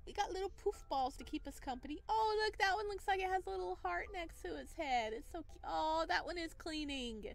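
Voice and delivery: usual speaking voice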